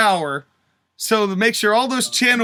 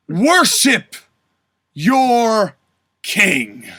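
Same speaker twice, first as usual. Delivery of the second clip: booming warrior king; 2 semitones higher, slower than usual. Acted. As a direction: drag it out like a king demanding worship. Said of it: "my version of Cody Rhodes".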